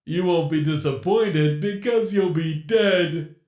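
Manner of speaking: a playful, menacing animatronic captor; sinister animatronic voice. Acted. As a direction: toy with your trapped prey. Captor's gloating joke.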